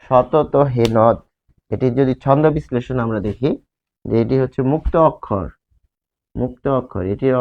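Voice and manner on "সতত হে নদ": sounded out slowly for analysis; slow recitation. Shown as a demonstration. Teacher slowly recites the line for the class to analyse. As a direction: read it slowly so every syllable shows. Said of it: "তাহলে এই যে".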